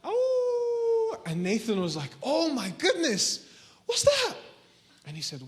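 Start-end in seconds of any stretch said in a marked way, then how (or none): 0.0-1.2 high, sustained wolf howl. Acted. Storyteller showing kids what noise a wolf makes, wolf howl. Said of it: "What noise does a wolf make?". 2.2-4.3 frightened alarm. Acted. Boy hears a wolf howl on the mountain, frightened alarm. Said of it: "sent chills down his spine".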